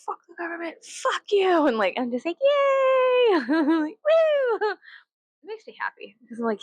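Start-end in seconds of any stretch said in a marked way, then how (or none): 0.0-1.7 put-on high-pitched, ranting old-lady cursing; mock angry old lady. Old ladies raging at the government, mock angry. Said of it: "old ladies being like". 2.4-4.8 very high, drawn-out cheer; mock squealing cheer. Fan cheering on old ladies, squealing.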